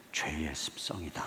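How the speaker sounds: low whisper to oneself; quiet whispered self-talk; whispered inner thought. Retold or imitated. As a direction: breathe it as a private realization. Inner monologue.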